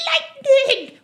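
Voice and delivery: high, loud triumphant announcement; showboating gnome woman. Acted. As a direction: announce it like a strongwoman's stage name. Gnome names her other arm.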